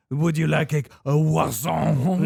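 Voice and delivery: loud mock-French offer, croissant stretched out; exaggerated French croissant. Imitating guys in a funny video, exaggerated French accent. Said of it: "the guys are just like".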